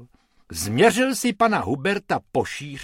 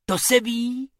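high-pitched happy agreement; jubilant; 11 semitones higher than usual. Acted. Bobek offered a part in an advert, jubilant. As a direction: agree gleefully at once. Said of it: "jásal Bobek".

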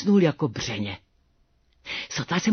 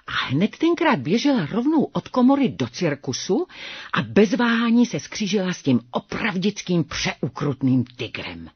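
plain reading voice

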